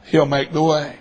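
low, soft, sure close; earnest reassurance. Retold or imitated. Customer closes his promise to waitress, softly reassuring. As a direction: settle down quietly on the promise.

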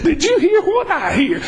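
mock gossip. Gossip voiced in mock excitement.

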